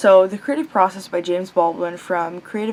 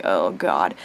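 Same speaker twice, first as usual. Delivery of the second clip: low groan; 14 semitones lower than usual. Retold or imitated. Dread at college workload.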